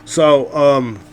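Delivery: ordinary talk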